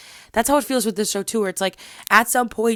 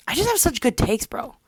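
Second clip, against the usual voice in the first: usual speaking voice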